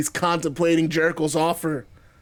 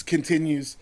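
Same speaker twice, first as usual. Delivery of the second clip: ordinary delivery, nothing marked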